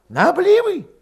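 high, loud urgent character voice; agitated villager. A narrator's reading. Urging his comrade to leave the village, agitated.

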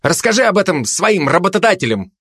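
high-pitched retort; testy comeback; snapping back. A narrator's reading. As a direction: snap back, mildly irritated. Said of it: "огрызнулся турист".

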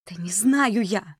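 high, drawn-out exasperation; exasperated protest. A narrator's reading. Маришка under Володя's questioning, exasperated. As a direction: protest slowly, pitch rising high.

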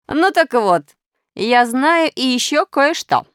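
hint that you know a secret; sly Minus, teasing. A narrator's reading.

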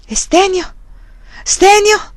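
put-on old man's cry. A narrator's reading. The old prior cries out for Stenio, high anxious call. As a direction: cry the name out, pitch climbing. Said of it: "gridò il vecchio".

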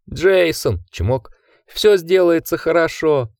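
высокий ликующий старческий голос; ликуй, будто пришёл спаситель; восторженный старик. A narrator's reading. Old man ecstatic kissing feet. (ликующий старик встречает спасителя)

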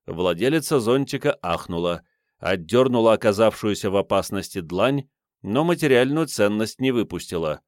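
ordinary delivery

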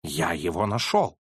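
low, calm statement; calmly. Acted. Holmes says he found the killer, low and calm. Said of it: "спокойно сказал Холмс".

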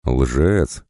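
ordinary delivery, nothing marked

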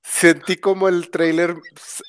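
usual speaking voice

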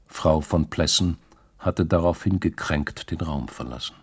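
ordinary delivery, nothing marked

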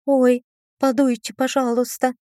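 высокая жалобная просьба; canючить, выпрашивая жалость; жалостливо. A narrator's reading. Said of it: "попросила я так жалостливо". (жалостливая просьба)

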